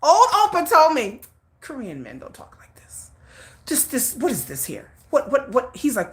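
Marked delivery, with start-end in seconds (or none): 1.6-3.1 whispered imitation of an appalled older man; shocked disapproval. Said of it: "he was shocked". 3.7-5.8 shocked disbelief. Retold or imitated. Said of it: "he was shocked".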